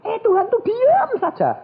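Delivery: very high, strained mock complaint; exasperated complaint. Retelling his complaint about God's silence, high exasperated cry.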